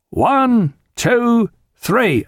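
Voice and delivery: high, sustained count-in; called-out count. A narrator's reading. Yeoman counting in the men, calling out. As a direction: call each number long and high. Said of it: "called out".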